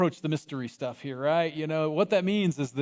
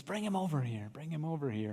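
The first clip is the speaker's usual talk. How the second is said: much softer, gentle command; calm summons; 9 dB quieter than usual. Acted. Jesus calling the blind man over, soft. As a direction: quietly call him over.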